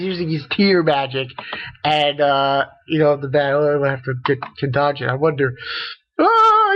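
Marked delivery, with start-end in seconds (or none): 6.2-6.7 high, loud pretend wail; hammed-up bawling; mock crying. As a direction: imitate someone bursting into tears. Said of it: "he's crying".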